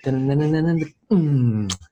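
high, rapid dun-dun build; imitated beat drop. DJ showing off a drop to the crowd, imitated beat. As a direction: vocalize a build-up into a humming drop.

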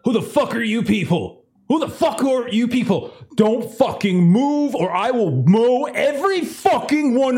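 high-pitched, frantic threats at intruders; hostile armed crewman. Acted. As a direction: play a panicked gunman demanding answers. Game master plays armed Lawrence confronting strangers.

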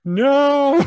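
high, loud mock wail; imitated crying toddler. Scared toddler refusing his parents' praise, imitated crying wail. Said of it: "started crying".

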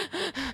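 high, soft hitching gasps; imitated crying sniffles. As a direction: mimic uncontrollable sobbing breaths. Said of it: "I kept doing that".

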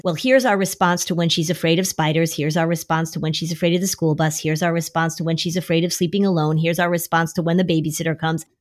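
fast, low, monotone recitation; rattled-off list. Acted. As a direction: reel them off like a tedious list. Listing separate responses to each fear.

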